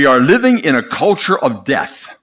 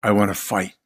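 hushed and faint, barely voiced; a frail patient's whispered plea; weak whisper; 5 semitones lower, 6 dB quieter than usual. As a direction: breathe it out like a dying man. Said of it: "and he whispered".

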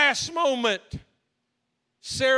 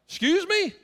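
surprised; 7 semitones higher than usual. Retold or imitated.

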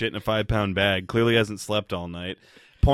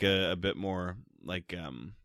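ordinary talk

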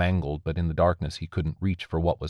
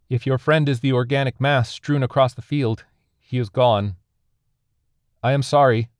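higher, louder call; raised entity voice; 7 semitones higher, 6 dB louder than usual. A narrator's reading. Alien tells survivor his friend is dead, raised voice. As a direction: carry the news across the field. Alien answers across the field.

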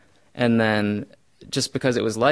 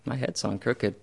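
soft, resigned and settled; just let it be true, softly; quiet calm acceptance; 8 dB quieter than usual. Retold or imitated. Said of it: "And it was acceptance".

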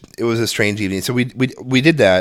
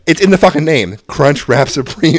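exasperated insistence; 3 semitones higher, 6 dB louder than usual. Retold or imitated. Exasperated father pointing at the name.

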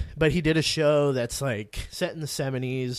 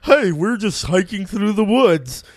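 loud, higher, slow sing-out greeting; cheery hiker; 7 semitones higher, 7 dB louder than usual. Retold or imitated. Australian hikers greeting strangers in the forest, cheery put-on voice. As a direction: call it out like a carefree hiker.

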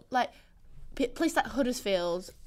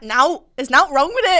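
loud, higher mock Bradford voice; hammed-up Bradford housemate; Bradford accent; 4 semitones higher, 11 dB louder than usual. Imitating housemate from Bradford to friends, loud Bradford accent. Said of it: "She was like".

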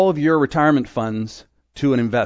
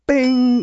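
1 bing sound; 11 semitones higher, 4 dB louder than usual. Shown as a demonstration. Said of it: "light bulb moment".

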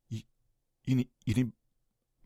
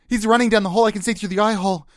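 ordinary delivery

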